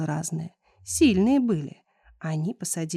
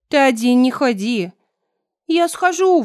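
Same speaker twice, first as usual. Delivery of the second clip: raised, loud parental warning; 6 semitones higher, 9 dB louder than usual. A narrator's reading. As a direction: warn the child loudly. Parents warn the son.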